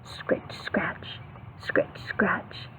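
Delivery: soft unvoiced scritch-scratch; whispered scratching. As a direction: whisper it like tiny bugs scratching.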